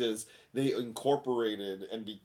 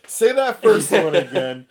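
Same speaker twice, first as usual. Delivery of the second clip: loud, higher retold demand, laughing; amused, raised-voice quote; incredulous request; 6 semitones higher, 11 dB louder than usual. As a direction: call it out in amused disbelief. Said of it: "And I went".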